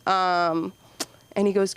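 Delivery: ordinary talk, nothing marked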